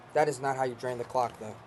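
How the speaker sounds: ordinary talk, nothing marked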